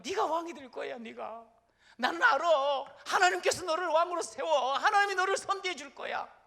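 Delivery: high and loud, Saul's retold cry; preacher voicing Saul, loud and high; tearful loud cry. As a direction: call it out in tears of gratitude. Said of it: "그렇게 큰 소리로 울면서 막 그렇게 외치는".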